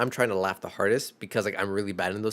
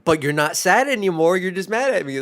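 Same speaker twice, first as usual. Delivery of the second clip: snarky retort; 8 semitones higher, 9 dB louder than usual. Retold or imitated. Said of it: "and I'm like".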